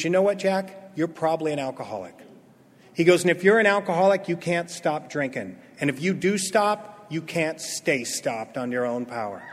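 calm, low-key counsel; gentle sponsor. Retold or imitated. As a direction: break hard truth quietly. Sponsor's gentle truth acted out.

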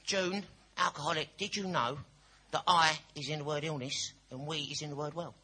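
hammed-up imitation of a meeting member; mock earnest copycat. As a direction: proudly recite someone else's line, loudly. Copycat repeats the line next day.

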